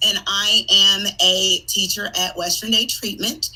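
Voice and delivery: ordinary delivery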